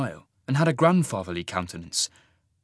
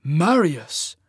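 startled, higher gasp of the name; 5 semitones higher, 4 dB louder than usual. Acted. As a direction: catch the breath on the name. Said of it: "Cole gasped".

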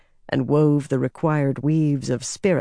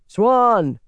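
raised, higher call for attention; 5 semitones higher, 5 dB louder than usual. A narrator's reading. A rider hails Swan from behind, calling out. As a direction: call the name out across a distance. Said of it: "a voice called".

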